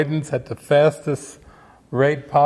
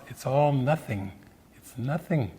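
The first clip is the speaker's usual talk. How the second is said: soft, hushed mock Alan Watts; lightly voiced calm sage, much softer; 7 dB quieter than usual. Voicing Alan Watts in your mind, softly hushed. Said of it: "there's still Alan Watts".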